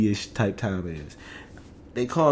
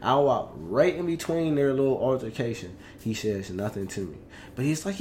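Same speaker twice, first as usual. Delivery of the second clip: usual speaking voice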